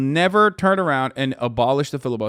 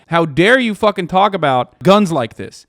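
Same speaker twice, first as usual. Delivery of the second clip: hammed-up outraged yell, louder; mock Republican shout; 6 semitones higher, 8 dB louder than usual. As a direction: sneer it as their real message. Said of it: "They're literally just saying".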